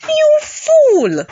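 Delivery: high, loud scolding cry; exasperated yell. Acted. Scolding Ginny over the hair brush, exasperated yell.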